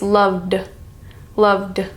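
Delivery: "loved" ending in a voiced "d". Shown as a demonstration. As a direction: end "loved" on a voiced "d" with no air. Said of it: "It's lower in pitch".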